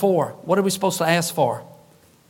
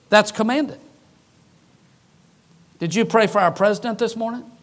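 normal talking voice

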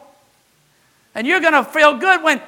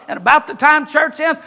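ordinary delivery

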